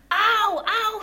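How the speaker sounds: loud, high yelps of pain; pained cry. A narrator's reading. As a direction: cry out as if suddenly stung. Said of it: "schrie er".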